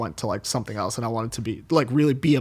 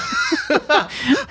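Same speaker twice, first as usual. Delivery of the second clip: high burst of laughter. Real, not acted. Laughs at own remark.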